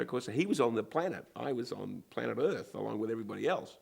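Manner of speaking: ordinary delivery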